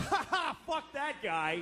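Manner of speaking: imitating a hippie's gleeful, high-pitched scoff; mocking laugh. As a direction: laugh out a slow, drawn-out mock jeer.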